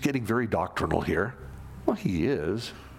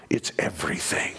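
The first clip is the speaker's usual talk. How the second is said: whisper; 6 semitones lower than usual. Real, not acted. Preacher to congregation, hushed emphatic whisper. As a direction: whisper it's everything to press the point.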